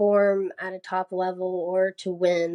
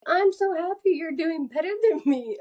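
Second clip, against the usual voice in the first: higher, sugary mock cheer; sweet cheering; 8 semitones higher than usual. Runner cheering the person in front of her. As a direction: beam at the rider ahead of you. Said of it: "I'm like".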